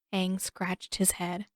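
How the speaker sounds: usual speaking voice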